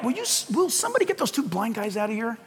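hurried mock appeal for someone to remove them; crowd's complaint. Crowd wanting blind men out, hurried soft complaint. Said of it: "The crowd saying".

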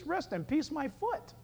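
scoffing. Acted. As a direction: toss the phrase back in disbelief. Mocks the funeral phrase.

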